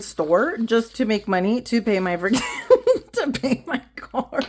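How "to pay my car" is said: ordinary talk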